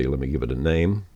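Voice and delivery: normal talking voice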